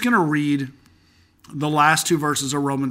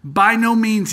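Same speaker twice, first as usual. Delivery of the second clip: high, loud By no means with a falling no; emphatic near-shout; 7 semitones higher, 6 dB louder, slower than usual. Shown as a demonstration. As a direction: hit the no hard, as if almost shouting. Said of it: "I'd shout it at you".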